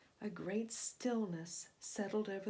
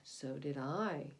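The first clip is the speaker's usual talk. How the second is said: slow, lower tired sigh; weary sigh; 8 semitones lower, 4 dB quieter, slower than usual. A narrator's reading. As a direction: breathe it out exhausted. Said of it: "sighed the serpent".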